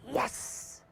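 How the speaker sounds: whispered yes of quiet triumph; husband's secret glee at his hit; gloating whisper. Acted. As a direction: hiss it to yourself, pleased. Husband gloats after hitting her.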